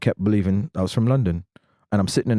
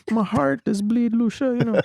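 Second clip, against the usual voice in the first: mock heartbroken.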